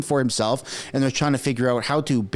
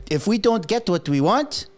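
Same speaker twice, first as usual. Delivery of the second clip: lightly put-on French-Canadian accent; English with a touch of Québécois accent; Quebec French accent; 5 semitones higher than usual. Bloc giving an ultimatum, light Quebec French accent. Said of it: "I could do that accent".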